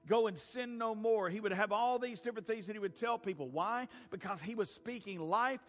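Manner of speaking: full-force preaching. Acted. Preacher driving his point home to the congregation, full-force preaching.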